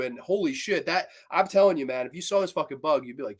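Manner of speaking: usual speaking voice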